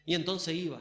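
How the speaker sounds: ordinary delivery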